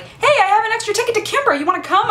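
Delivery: high, fast, bubbly invitation; friend's offer. Retold or imitated. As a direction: pitch the ticket brightly. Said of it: "So when Jeannie was like".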